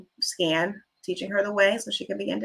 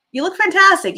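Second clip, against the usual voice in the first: excited compliment; 10 semitones higher, 9 dB louder than usual. Retold or imitated. Complimenting a ninety-year-old lady.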